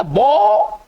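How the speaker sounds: बो drawn out, no final n; बो held long for teaching; French pronunciation demo. Shown as a demonstration. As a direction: stretch बो into one long open syllable. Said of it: "इसको क्या पढ़ेंगे".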